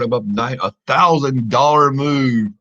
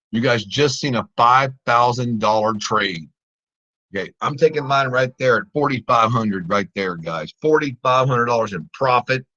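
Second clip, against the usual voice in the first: ordinary talk, nothing marked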